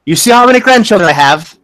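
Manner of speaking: intimidating mother; mom voice. Acted. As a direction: demand it like an indignant grandmother. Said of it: "this is motherly intimidation".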